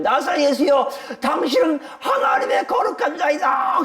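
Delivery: high-pitched, drawn-out shout; possessed man screaming. Acted. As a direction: cry out like a demon-possessed man. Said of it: "소리 지르는 거예요". (귀신 들린 자가 높게 소리 지르듯)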